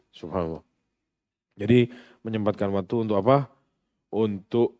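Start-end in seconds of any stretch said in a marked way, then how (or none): none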